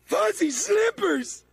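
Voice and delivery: high, slow, awestruck cry; villain hailing the fuzzy slippers with awe; dramatic exclamation. Acted. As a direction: proclaim it like a treasure.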